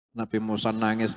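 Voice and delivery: ordinary delivery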